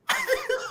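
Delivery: short high burst of laughter. Real, not acted. Catcher laughing at his own retort, high burst.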